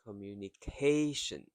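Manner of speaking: "communication" quick at first, then a long, stressed "ca"; slowed stressed syllable. Shown as a demonstration. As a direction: stretch "ca" in "communication", ending lightly on "tion". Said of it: "K slows down".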